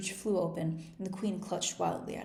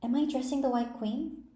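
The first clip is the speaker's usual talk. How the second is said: timid; 6 semitones higher, 4 dB louder than usual. A narrator's reading. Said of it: "she began rather timidly".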